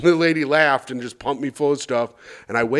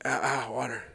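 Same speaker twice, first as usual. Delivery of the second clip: faint, soft parched rasp; groggy dry mouth; 3 semitones lower, 11 dB quieter than usual. Retold or imitated. Patient waking from anesthesia begging for water, groggy. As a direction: croak it out half awake from anesthesia. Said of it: "My mouth was like dry as hell".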